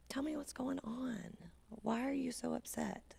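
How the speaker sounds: soft gentle whisper. Shown as a demonstration. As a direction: whisper it calmly to soothe an upset child. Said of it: "lowering your voice to a very calm level".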